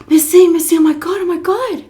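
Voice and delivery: high, loud put-on excitement; fake excitement. Caregiver distracting Miss C, high excited voice. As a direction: gush to distract her. Luring the mother away, acted excitement.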